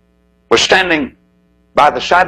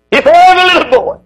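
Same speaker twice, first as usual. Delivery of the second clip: impassioned cry; 5 dB louder than usual. Real, not acted. Preacher pleading for fathers to his congregation, a high loud cry. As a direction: cry it out to the whole church.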